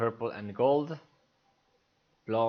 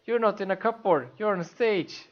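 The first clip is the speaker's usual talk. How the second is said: high, loud scolding coach; loud stage direction; 10 semitones higher, 6 dB louder than usual. Acted. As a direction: shout him onto the stage. Lofty directing, demanding projection.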